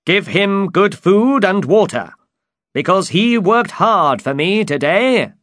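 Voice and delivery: high, loud, courtly knight; lofty high-pitched storybook knight; Don Quixote. Acted. Don Quixote addresses the innkeeper.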